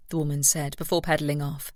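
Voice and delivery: usual speaking voice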